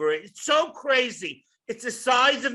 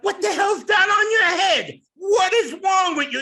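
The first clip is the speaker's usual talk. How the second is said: higher, louder indignant scolding; family scolding; 7 semitones higher, 5 dB louder than usual. Retold or imitated. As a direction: berate him like an appalled relative. Family scolding him for kippah.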